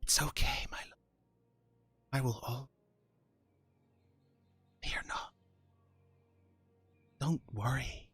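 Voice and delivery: soft, breathy-free hush of a hidden voice; whispering voice. Acted. Patron's voice whispering comfort to Arno. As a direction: murmur it softly like a presence in his head. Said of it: "a voice whispering to you".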